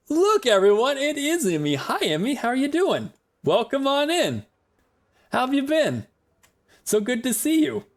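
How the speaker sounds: thrilled to see a viewer arrive; excited greeting. Real, not acted. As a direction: greet Emmy like a long-lost friend.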